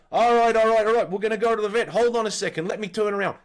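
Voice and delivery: lightly voiced stepdad, loud at first; placating stepdad. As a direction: imitate a flustered man agreeing under pressure. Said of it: "And then John goes".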